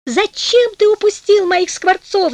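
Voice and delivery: высокий протяжный упрёк; упрекай высоко и медленно, сквозь огорчение; плачущий крик жены. A narrator's reading. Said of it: "закричала она". (крик с укором)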